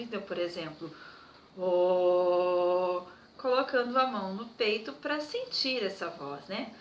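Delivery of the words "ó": sustained low chest-voice "oh". Shown as a demonstration. Music teacher shows children a low chest sound, low held note. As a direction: hold a strong low "oh". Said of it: "façam um som grave".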